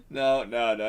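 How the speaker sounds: ordinary talk, nothing marked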